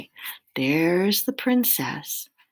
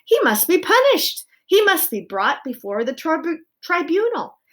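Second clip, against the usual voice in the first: high, loud demand; angry shouting; 10 semitones higher, 6 dB louder than usual. A narrator's reading. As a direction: shout it like a verdict. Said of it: "shouted Lester".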